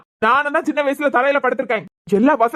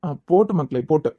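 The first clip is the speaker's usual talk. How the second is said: put-on soothing baby; 9 semitones lower than usual. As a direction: lull the baby gently. Father hushing crying baby.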